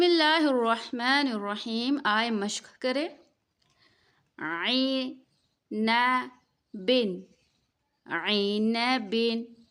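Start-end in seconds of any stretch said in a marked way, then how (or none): 4.4-9.6 عین بن drawn out slowly, each syllable clear; slow tajweed drill. Shown as a demonstration. Teacher modelling عین بن slowly for students. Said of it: "مشق میں دو چیزوں کا لحاظ".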